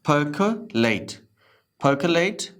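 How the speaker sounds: percolate as "puh-kuh-late", no R; slow percolate with the R dropped; silent R. Shown as a demonstration. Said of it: "आर के बाद सी है तो नहीं पढ़ेंगे".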